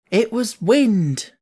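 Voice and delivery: slow, dragged, stubborn insistence on wind. A narrator's reading. Martha stubbornly denies the crying to Mary, slow and firm. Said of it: "said Martha stubbornly".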